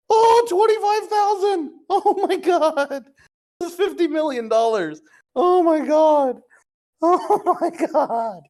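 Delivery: giggling in real shock; cracking up at the absurd number; laughing disbelief. Real, not acted. Another huge reveal.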